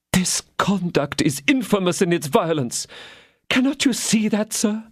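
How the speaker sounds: a hint of a proud queen protesting; indignant queen. Queen rebuking the Chancellor, lightly voiced indignation.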